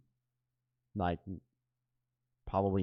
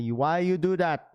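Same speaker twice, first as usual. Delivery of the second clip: a lightly voiced Filipino mom, a bit higher and louder; Filipino mom accent; 5 semitones higher, 3 dB louder than usual. Mother scolding son over his first tattoo, a light Filipino mom accent. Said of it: "exactly how my mom sounds like".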